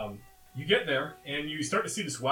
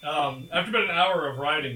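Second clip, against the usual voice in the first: ordinary talk, nothing marked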